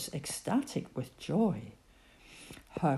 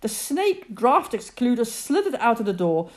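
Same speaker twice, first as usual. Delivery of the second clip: plain reading voice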